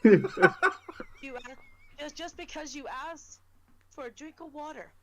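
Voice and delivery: a hint of theatrical delivery; lightly overacted, stage style; hammy stage actor. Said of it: "You put on the theatrical voice there".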